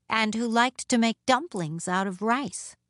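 usual speaking voice

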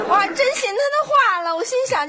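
very high, aggrieved outburst; exasperated lament. Acted. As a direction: bemoan your own gullibility, pitched high. Wife laments believing the seller. (懊恼地高声诉苦)

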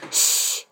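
sharp unvoiced shh through the teeth; whispered shush. Hetton silencing Baiwanfu's dirty joke, a sharp shush. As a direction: hush him quickly, finger to lips. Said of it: "赫顿把一只手指竖在唇边".

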